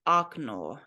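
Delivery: "okno" said as ak-NO; "okno" with stress on the final O; unstressed O as ah. Shown as a demonstration. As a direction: open "ah" for the first O in "okno". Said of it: "when it is not emphasized".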